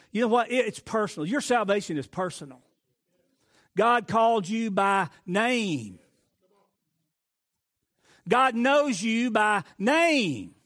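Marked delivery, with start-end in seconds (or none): 3.8-6.0 slow emphasis. Real, not acted. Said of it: "slow emphatic preaching". 8.3-10.5 slow emphasis. Real, not acted. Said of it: "slow emphatic preaching".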